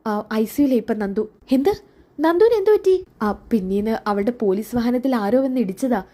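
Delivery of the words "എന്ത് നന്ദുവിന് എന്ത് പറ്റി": much higher and louder, jolted; a sudden frightened outburst; shocked cry. Acted. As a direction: son shocked by news of the accident. Son hears lover is in ICU.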